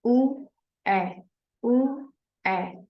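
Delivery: drawn-out vowel sounds ऊ and ए said one after the other; vowel sound demo. Shown as a demonstration. Teacher modelling the mid vowels ऊ and ए for students. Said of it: "वो वाली जो sound आती है ना".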